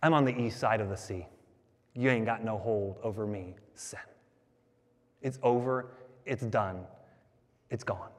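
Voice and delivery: low, soft and final; quiet declaration. Acted. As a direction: drop low and hushed, with pauses between phrases. Declares freedom from sin, hushed.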